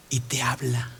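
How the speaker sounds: soft, low near-whisper; hushed. Shown as a demonstration. Preacher telling how God comes down to your level, hushed. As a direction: drop to an intimate hush.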